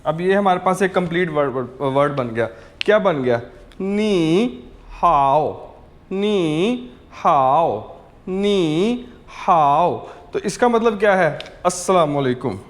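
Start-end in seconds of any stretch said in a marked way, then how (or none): 3.8-10.0 नी हाओ stretched very slowly, syllable by syllable; drawn-out teaching model of नी हाओ; slow careful ni hao for learners; slow Chinese demo. Shown as a demonstration. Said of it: "कैसे बोलेंगे Chinese के अंदर".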